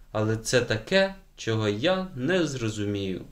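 ordinary delivery

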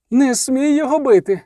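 high-pitched cry of protest; urgent, high woman's outcry; sudden cry. A narrator's reading. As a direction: cry out abruptly, pitch far up. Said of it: "зовсім несподівано закричала Аделя".

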